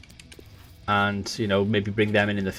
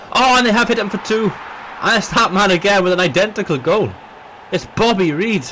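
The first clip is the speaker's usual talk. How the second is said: high, loud goal call; excited; 10 semitones higher, 6 dB louder than usual. Acted. Second goal called.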